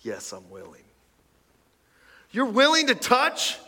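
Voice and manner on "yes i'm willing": much softer, lower and calm; quiet gentle reply. A narrator's reading. Jesus answers the kneeling leper, softly and gently. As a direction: say it softly and plainly, as a simple reassurance. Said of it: "understatement of the year as an answer".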